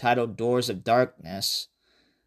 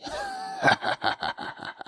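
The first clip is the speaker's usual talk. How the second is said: evil cackle; 7 dB quieter than usual. Acted. Creepy voice ends with laugh.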